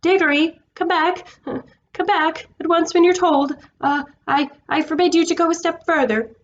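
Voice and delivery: a timid uncle's weak shout; half-hearted shout. A narrator's reading. Uncle Andrew calling the boy back, half-hearted high shout. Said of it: "shouting, but by no means at the top of his voice".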